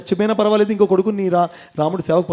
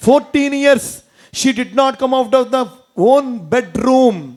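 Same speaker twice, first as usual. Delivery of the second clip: dramatic slow emphasis; drawn-out emphasis; 4 semitones higher, much slower than usual. Shown as a demonstration. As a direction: lean hard on each word, slowly. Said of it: "stretched English emphasis".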